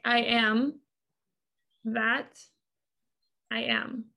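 "I am", long pause, "that", long pause, "I am"; THAT set apart. Shown as a demonstration. Guest explaining the quote to host, "that" set apart by pauses. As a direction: isolate "that" between two long pauses. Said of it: "if I picked up something".

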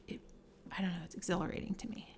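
whispered and fast, almost to herself; hushed rush of excitement; breathless whisper. Real, not acted. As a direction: let it slip out as a quick whisper. Sharing a thrilling feeling.

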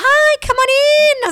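mock sweet greeting. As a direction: sing out a bright hello. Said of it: "I just walked around and went".

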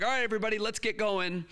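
high, loud teacher call; young teacher calling students, high and loud; calling the class. Retold or imitated. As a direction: call a noisy class to order. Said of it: "I'm like".

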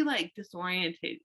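ordinary delivery

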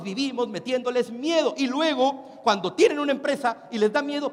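usual speaking voice

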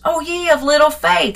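a touch of Jesus being fed up with them; put out. Retold or imitated. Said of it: "he's kinda put out with them".

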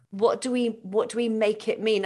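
ordinary talk

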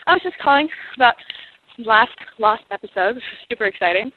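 usual speaking voice

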